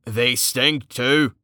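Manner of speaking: slow, drawn-out character voice; gruff companion's aside. Acted. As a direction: drag out the complaint. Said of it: "Carten said".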